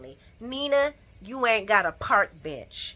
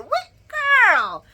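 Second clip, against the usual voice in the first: indignant question; 13 semitones higher, 6 dB louder than usual. Real, not acted. Indignant at Eden.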